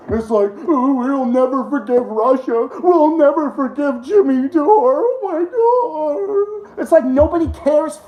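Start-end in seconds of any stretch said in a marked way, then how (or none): 0.7-6.8 high, drawn-out fake crying; hammed-up tearful mockery, climbing higher; mocking whine. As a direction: wail it like a sobbing crybaby. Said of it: "mocking the shit out of these".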